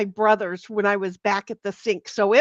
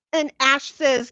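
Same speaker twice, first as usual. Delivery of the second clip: ordinary delivery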